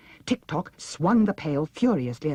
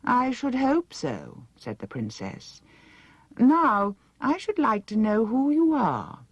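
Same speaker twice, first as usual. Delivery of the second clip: higher, slow, grand and loud; haughty princess; 9 semitones higher, 4 dB louder than usual. A narrator's reading. Vain princess ordering Dorothy to follow, high and slow.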